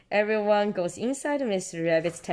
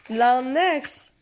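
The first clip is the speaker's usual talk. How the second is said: giggling through the words; laughing; 9 semitones higher than usual. Real, not acted. Laughing at long neck.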